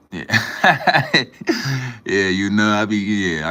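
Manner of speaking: high, loud burst of laughter. Real, not acted. Streamer laughing at a chat comment, loud laugh. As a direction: crack up at a comment.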